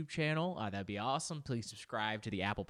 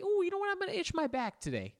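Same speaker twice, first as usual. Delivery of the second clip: high-pitched playful realisation; mock self-treat delight. Person talking to themself about a back scratcher, playful high delight. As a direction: pipe up high as if hit by a treat idea. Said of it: "and you say".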